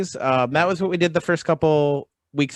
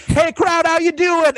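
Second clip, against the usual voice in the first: higher, louder showman hello to the crowd; mock crowd greeting; 8 dB louder than usual. Louis greeting the theater crowd, hammed-up louder, higher showman call. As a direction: boom it out like a headliner taking the stage.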